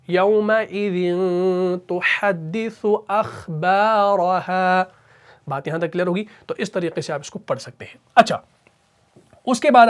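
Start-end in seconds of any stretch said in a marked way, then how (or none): 0.1-4.8 تحدث اخبارها slowly, with a clear tha plus u; slow Quran recitation. Shown as a demonstration. Teacher reciting the verse in full, slow tajweed. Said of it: "سا پیش".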